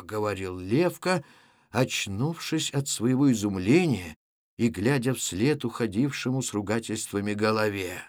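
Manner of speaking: plain reading voice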